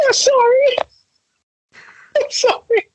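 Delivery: high, squeaky words through laughter; laughing apology. Real, not acted. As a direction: apologize while unable to stop laughing. Apologizes during a laughing fit.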